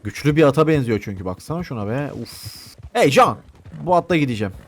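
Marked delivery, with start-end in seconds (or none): 2.9-3.4 louder, higher shout for attention; calling out. Pretend. Gamer calling John about the horse, calling out. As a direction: hail a companion across the way. Calls out to game companion.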